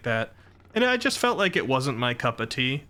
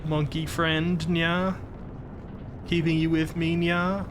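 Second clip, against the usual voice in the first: high, sweet nya talk; cutesy nya speak; 5 semitones higher than usual. Shown as a demonstration. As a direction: coo to the monkey, cat-girl style. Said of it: "We're ending every sentence with".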